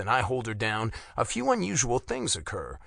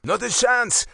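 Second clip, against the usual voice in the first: loud, high-pitched retort; sharp rebuff to a struggling captive; forceful refusal; 11 semitones higher, 6 dB louder than usual. A narrator's reading.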